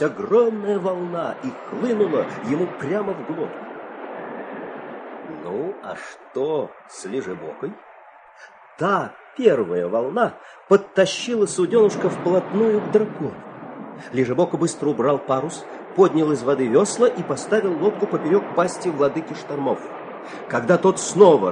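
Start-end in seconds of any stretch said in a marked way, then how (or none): none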